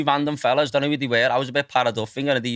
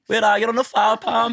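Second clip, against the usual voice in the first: mock yell; 10 semitones higher than usual. Mate angrily phoning about location.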